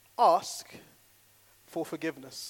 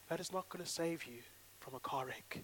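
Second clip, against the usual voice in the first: usual speaking voice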